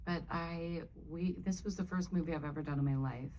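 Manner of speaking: normal talking voice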